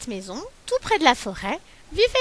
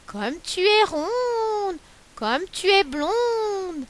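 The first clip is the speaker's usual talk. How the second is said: higher, very slow honeyed flattery; a cunning fox buttering her up; sly fox flattering; 8 semitones higher, much slower than usual. Acted. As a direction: fox sweet-talking the galette, slow and sly. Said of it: "dit le malin renard".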